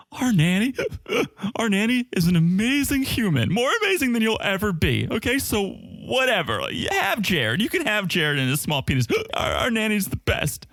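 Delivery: high, tipsy, emotional slur; like a drunk girl in a bar bathroom. Retold or imitated. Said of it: "she sounds like a drunk girl in the bathroom of a bar".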